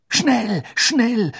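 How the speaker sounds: put-on girl desperate to escape; desperate groan. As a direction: groan it out in panic. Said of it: "stöhnte Hermine".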